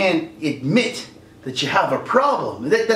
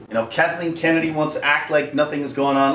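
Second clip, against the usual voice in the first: normal talking voice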